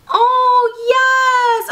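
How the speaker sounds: high, loud, long-held cry; triumphant cheer. Real, not acted. Vlogger figuring out the travel toothbrush, triumphant cheer. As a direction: stretch it out in loud delight. Gadget suddenly works.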